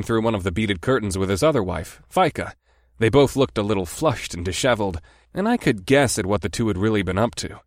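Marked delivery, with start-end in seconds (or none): none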